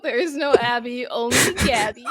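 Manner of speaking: quoting Zool. Retold or imitated. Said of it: "the Zool quote".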